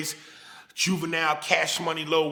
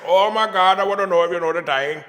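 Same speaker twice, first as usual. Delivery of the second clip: mock Indian accent, louder and quick; 5 dB louder than usual. Comedian mocking an Indian accent, hammed-up and loud. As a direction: ham up an Indian accent for laughs. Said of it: "Versus the Indian accent".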